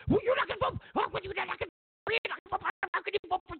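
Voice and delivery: very high, spluttering mock disbelief; mock indignant pestering. Pushy friend badgering a hesitant voter, high mock outrage. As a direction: badger them in a squeaky put-on voice. Acted pressuring of a voter again.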